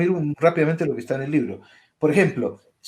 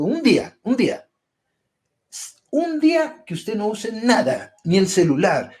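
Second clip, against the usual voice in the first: insistent challenge; 5 semitones higher than usual. Retold or imitated. Doctor challenging patients, quoted.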